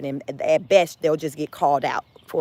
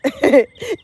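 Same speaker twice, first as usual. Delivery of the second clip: short laugh; 8 semitones higher, 8 dB louder than usual. Real, not acted. Laughs at own tough questions.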